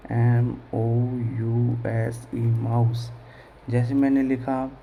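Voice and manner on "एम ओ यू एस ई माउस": slow letter by letter; slow classroom spelling; spelled out slowly. Shown as a demonstration.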